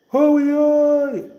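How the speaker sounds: very high, loud, long-held holler; sing-out hail, stretched on one high note; long-distance call. Shown as a demonstration. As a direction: sustain the call so it carries far. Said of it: "we a call somebody".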